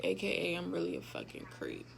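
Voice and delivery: usual speaking voice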